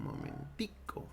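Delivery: "momentico" said slowly and low in a Colombian or Venezuelan lilt. Shown as a demonstration. Teacher showing how Colombians say the diminutive, slow and low. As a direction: stretch out "momentico" the Colombian way, ending on a rise. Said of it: "en Venezuela, en Colombia, dirían".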